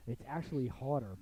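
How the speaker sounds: ordinary talk, nothing marked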